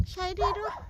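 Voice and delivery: high soft coaxing call; squeaky praising a dog. Real, not acted. Calling the dog over.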